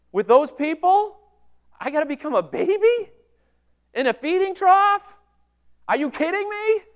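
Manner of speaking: hammed-up spoiled complaint, voice climbing; mock disbelief at becoming a baby in a trough; mock whiny outrage. Jesus imagined balking at the mission.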